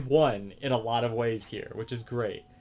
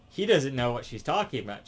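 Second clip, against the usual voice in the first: ordinary talk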